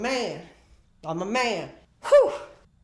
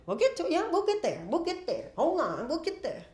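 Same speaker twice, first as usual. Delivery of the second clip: soothing himself; 7 semitones higher, 3 dB quieter than usual. Acted. High soothing self-talk.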